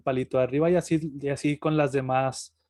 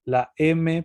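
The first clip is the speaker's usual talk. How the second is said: normal talking voice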